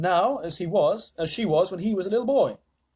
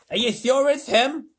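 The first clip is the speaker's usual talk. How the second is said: louder, higher order; commanding president; 9 semitones higher, 6 dB louder than usual. A narrator's reading. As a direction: demand it at once. President asks.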